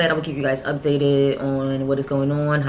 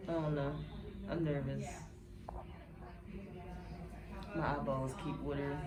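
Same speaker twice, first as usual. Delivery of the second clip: hushed; 17 dB quieter than usual. Real, not acted. Nervous before surgery, hushed.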